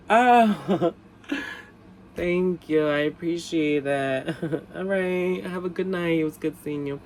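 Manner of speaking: high, sweet, giggly politeness; imitated coy thanks. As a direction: act flattered and over-polite, with little laughs. Acts own coy thanks, hiding outrage.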